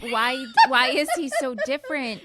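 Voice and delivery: giggling through the question; high, loud, cracking up with laughter; incredulous question bursting with laughter; laughing. Real, not acted. Laughing fit over an odd voice.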